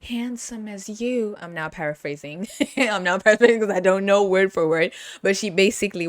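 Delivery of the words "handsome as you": slow, higher and gentle; soft-spoken Susan. Retold or imitated. Imitating Susan flirting with Joe, slow and higher. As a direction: draw it out sweetly. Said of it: "She said".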